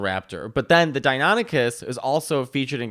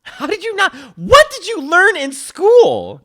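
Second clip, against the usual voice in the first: incredulous; 10 dB louder than usual. Real, not acted. Incredulous at co-host's ignorance.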